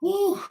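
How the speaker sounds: short high "woo"; quick falsetto "woo"; high whoop. Real, not acted. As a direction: blow off effort with a high whoop. Catching breath between takes.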